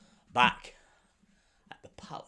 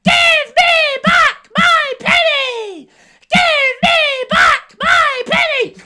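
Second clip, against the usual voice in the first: high, slow, shrieking cockerel cry; much higher squawking rooster demand; angry cockerel; 18 dB louder, slower than usual. Acted. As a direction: crow it out furious at the sultan. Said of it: "Can you try that?".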